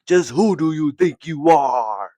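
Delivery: 하늘이 꾸짖듯 살짝 크게 연기한 목소리; 가볍게 위엄을 흉내 낸 꾸짖음; mock heavenly rebuke. Heavens rebuke, voiced by dad.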